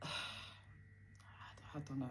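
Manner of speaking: sigh, then a very slow, soft whisper; defeated whisper. Real, not acted. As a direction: let it fade out on a breath. Recalling dad looking deflated.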